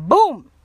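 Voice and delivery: sudden high, loud boom; loud "boom" sound effect. Retold or imitated. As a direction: punch out a crashing boom. Imitates phone falling.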